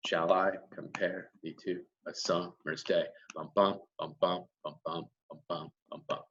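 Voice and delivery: soft, low da-DUM pulse on "Shall I compare thee", then on "bum, bum"; heartbeat rhythm. Shown as a demonstration. Teacher likening the poem to a human heart, soft thumping pulse. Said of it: "the beat mimics the beat of the human heart".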